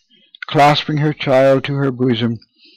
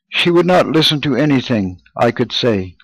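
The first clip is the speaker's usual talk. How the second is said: plain reading voice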